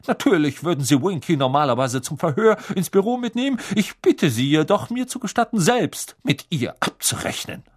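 loud, curt, brisk official. A narrator's reading. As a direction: say it brusquely, no room for argument. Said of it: "sagte Mister Crouch schroff".

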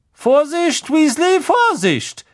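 high, loud warning cry; calling out. Acted. Professor Sprout yelling at Weasley over the beans, alarmed. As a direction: shout across the greenhouse to stop him. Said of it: "rief Professor Sprout".

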